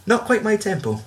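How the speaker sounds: lightly high, lilting teacher quote; quoting famous film line. Retold or imitated. Quoting the teacher's famous line to a friend, mock high lilt. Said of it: "we get the famous line".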